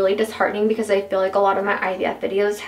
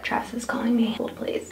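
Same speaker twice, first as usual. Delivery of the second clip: whispering; 6 dB quieter than usual. Real, not acted. Husband is calling.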